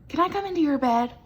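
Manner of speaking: little mouse begging again; little mouse pleading. A narrator's reading. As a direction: hurry the question like an eager mouse. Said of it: "asked Little Mouse".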